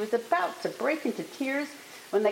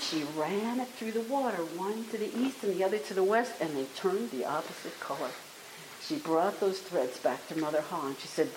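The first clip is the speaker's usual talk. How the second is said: ordinary delivery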